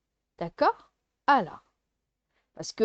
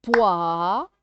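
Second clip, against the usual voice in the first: "Poire" split into loud rising "poi" and separate "re"; syllable by syllable; 3 semitones lower, 6 dB louder than usual. Shown as a demonstration. Teacher sounding out syllables for a child, "Poire" split in two. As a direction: stretch "Poire" in two beats with a pause. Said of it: "de faire les syllabes".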